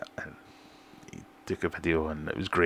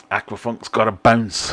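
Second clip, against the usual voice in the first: put-on half-hearted try at Aquafunk's accent, louder and slow; mock Aquafunk accent; 4 semitones higher, 8 dB louder than usual. Streamer reading a viewer's sign-off in a mock Aquafunk accent. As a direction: read his sign-off in his accent, then give up. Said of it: "I can't do the accent".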